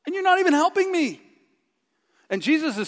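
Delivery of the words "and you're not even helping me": much higher, indignant whine; whiny complaint. Retold or imitated. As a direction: complain like a hurt martyr. Resenting Jesus while overworking.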